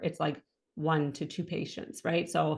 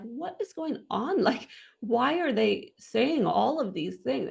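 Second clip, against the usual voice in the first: retold bewilderment, much higher swooping pitch; bewildered; 6 semitones higher than usual. Patient wondering at the nurses around her, bewildered. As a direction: act out baffled disbelief at what's overheard.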